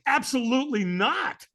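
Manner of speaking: high, slow, drawn-out firm no; emphatic refusal. Retold or imitated. Firewalker turning down the stranger's invitation, emphatic refusal. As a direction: shut him down with a stretched-out no. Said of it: "I said it that way, you know, with the tonality".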